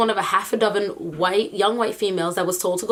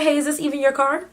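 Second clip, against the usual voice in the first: lightly voiced cop; 6 semitones higher than usual. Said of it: "nobody's gonna stop me and be like".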